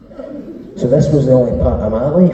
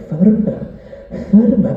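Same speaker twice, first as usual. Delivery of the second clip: higher, a hint of a posh Scottish old lady; 10 semitones higher than usual. Old lady asks shampoo boy to scrub firmer, lightly voiced higher. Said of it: "a dead posh Scottish lady".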